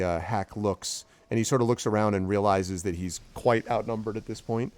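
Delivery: ordinary talk, nothing marked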